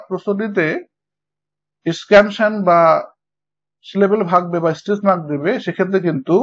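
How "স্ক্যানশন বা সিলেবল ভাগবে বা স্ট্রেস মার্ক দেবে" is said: normal talking voice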